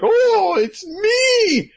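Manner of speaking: high squealing "it's me!"; squeaky reveal. Acted. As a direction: pop out with a shrill, loud squeal. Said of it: "stupid-faced disguise who's like".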